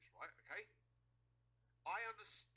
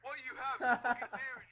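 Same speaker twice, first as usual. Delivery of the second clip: louder and higher, breaking into laughter; laughing taunt; 8 semitones higher, 15 dB louder than usual. Real, not acted. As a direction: jeer while cracking up. Mocking insult during heated argument.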